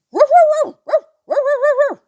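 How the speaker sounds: high, loud woofing like a dog; yappy dog woofs; dog barking. Acted. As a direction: bark like the farm dog.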